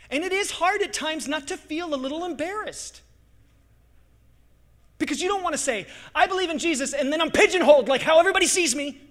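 loud, high, driving sermon push; preaching at full force. Acted. Pastor to congregation, loud fervent preaching. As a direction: preach it hard to the crowd.

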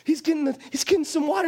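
ordinary delivery

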